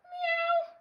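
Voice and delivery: piercing, loud, very high meows; cat meow. Acted. Freed cat pulled out by vet, loud high meows. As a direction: squeal out two loud meows. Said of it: "The cat went".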